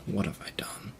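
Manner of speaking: soft, half-whispered self-reproach; hushed remorse. Acted. Prisoner alone in his cell regretting his affair, hushed remorse. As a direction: let it slip out quietly, appalled at yourself.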